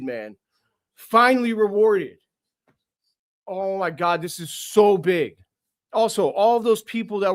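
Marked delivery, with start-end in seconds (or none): 0.9-2.2 higher and louder release; real joyful cry; excited shout. Real, not acted. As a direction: let the relief burst out. Fan celebrating draft lottery win.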